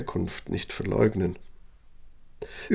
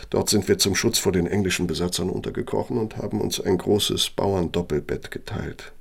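normal talking voice

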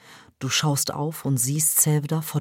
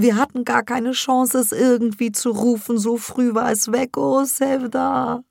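tearful; 8 semitones higher, 6 dB louder than usual. Acted. Crying mother tells Sevda of her lost baby. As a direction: plead through tears. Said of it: "dicke Tränen über die Wangen".

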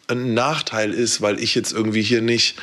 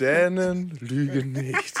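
langsam, leise, vor Lachen; lachend gesprochen; 4 semitones higher, 4 dB quieter, slower than usual. Real, not acted. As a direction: den Satz durchlachen. Repeats joke while laughing. (lachend, Dänen lügen nicht)

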